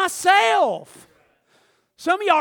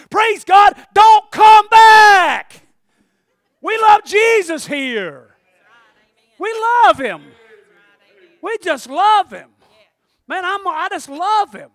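loud, higher, slowed praise; preaching at full force; 5 semitones higher, 9 dB louder, slower than usual. Real, not acted. Preacher stirring up the church, loud and slow. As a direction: preach it out, stretching each word.

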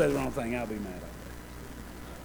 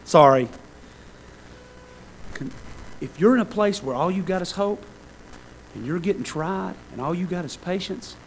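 ordinary talk, nothing marked

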